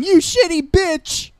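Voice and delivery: high, drawn-out mock yell. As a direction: yell it slowly in a high put-on voice. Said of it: "Just a chick's boobs yelling".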